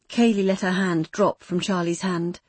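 ordinary delivery, nothing marked

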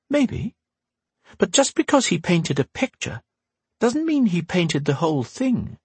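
a hint of a wise old teacher; Windy's voice. A narrator's reading.

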